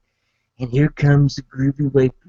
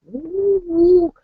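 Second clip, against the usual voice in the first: very high cartoonish woo woo; shrimp noises. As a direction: give the little shrimp a squeaky voice. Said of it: "they go".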